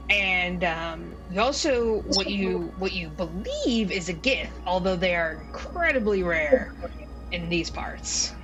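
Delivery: normal talking voice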